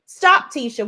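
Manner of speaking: normal talking voice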